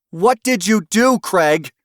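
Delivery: high, loud furious demand; demanding shout. Acted. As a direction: shout at the husband. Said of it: "Doreen demanded".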